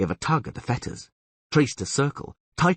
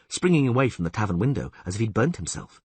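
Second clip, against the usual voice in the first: usual speaking voice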